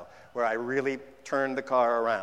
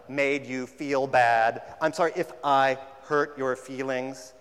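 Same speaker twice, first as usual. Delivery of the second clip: slow, louder mock apology that dodges blame; hollow non-apology; 4 dB louder, slower than usual. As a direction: say it like you're not really sorry. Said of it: "distances you from taking any real responsibility".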